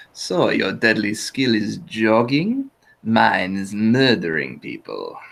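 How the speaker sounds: slow, menacing put-on spy voice; deliberate character sneer, very slow; TF2 Spy. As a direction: draw out the taunt like a suave assassin. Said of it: "I do a little bit of the spy".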